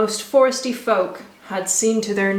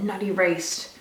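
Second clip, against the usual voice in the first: ordinary delivery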